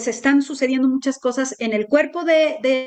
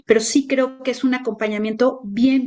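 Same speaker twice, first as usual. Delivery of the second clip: normal talking voice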